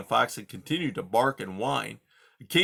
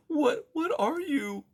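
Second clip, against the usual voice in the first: high, slow trembling mouse voice; scared mouse asks the porcupine; frightened mouse; slower than usual. Acted. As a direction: ask it terrified. Poppy afraid.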